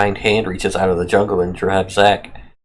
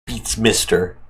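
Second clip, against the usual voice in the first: ordinary talk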